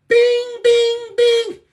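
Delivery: piercing high sing-song bings; high bell chime. Pretend. Streamer marking each sentence with high mock bell bings. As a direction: ring it out like a bell.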